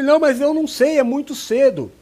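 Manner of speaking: protest, scared of preaching so soon; flustered protest. Retold or imitated. Retold own panicked refusal.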